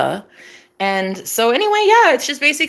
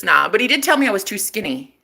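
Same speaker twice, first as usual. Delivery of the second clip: normal talking voice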